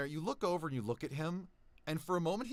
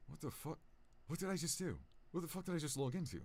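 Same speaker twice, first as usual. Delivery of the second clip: soft, rattled muttering; whisper-ish shock at your own screen; quiet alarmed mutter; hushed disbelief; 3 semitones higher, 7 dB quieter, faster than usual. Acted. NPC shocked at logged-in account.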